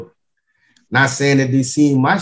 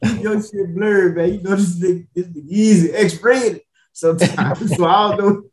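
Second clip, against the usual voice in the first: laughing; 6 semitones higher than usual. Real, not acted. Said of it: "I'm laughing".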